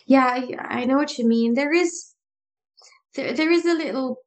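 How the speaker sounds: normal talking voice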